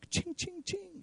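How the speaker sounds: high, soft jingling "ching, ching, ching"; ching sound effect. Acted. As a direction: tinkle it like a lure. Devil jingling bait sound.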